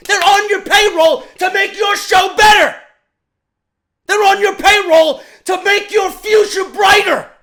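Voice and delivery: loud, high angry yelling; furious shout. Real, not acted. Fan yelling at WWE bookers about their roster. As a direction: blast it at the bosses.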